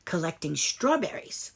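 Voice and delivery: plain reading voice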